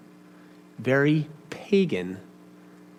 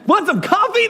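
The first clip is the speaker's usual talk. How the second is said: much higher, bright and eager offer; perky barista; 4 dB louder than usual. Retold or imitated. Imitating a cheery Dutch Brothers barista offering coffee, excited. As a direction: chirp it like an overjoyed barista. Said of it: "They're like".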